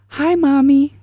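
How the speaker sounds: put-on high, loud child's call, held long; excited little boy. Son calls to his mom from the nurse's office, excited. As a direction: imitate a small son calling out, delighted. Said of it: "to hear that little voice, how excited he was".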